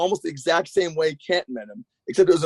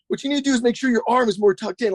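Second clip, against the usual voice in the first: high-pitched imitation of a kinesiology nerd; mock tech wizard giving precise coaching, pitched up; Roddy Mullen getting technical; 7 semitones higher than usual. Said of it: "he starts getting all kinesiology".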